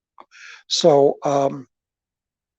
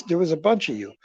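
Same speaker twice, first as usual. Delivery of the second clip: usual speaking voice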